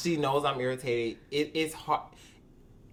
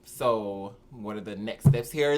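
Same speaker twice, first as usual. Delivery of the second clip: much lower, curt and irritated; dropped low voice; 8 semitones lower than usual. Retold or imitated. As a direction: drop it low, clearly annoyed. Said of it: "my voice dropped down to".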